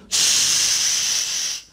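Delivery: long steady steam hiss; pressure cooker hiss. Retold or imitated. Preacher imitating a pressure cooker releasing, long hiss. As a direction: imitate steam escaping from a valve. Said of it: "langsung bunyi".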